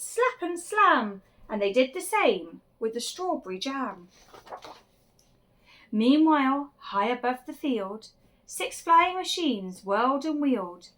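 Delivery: usual speaking voice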